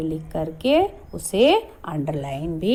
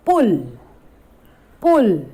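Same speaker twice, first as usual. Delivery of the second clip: louder, each कुल called out; clear drilled word; 5 dB louder than usual. Shown as a demonstration. Teacher modelling a word for children, loud. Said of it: "छोटे ऊ की मात्रा लगाएंगे".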